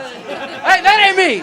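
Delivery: high, loud denial; loud protesting retort. Real, not acted. Rebuts a heckle.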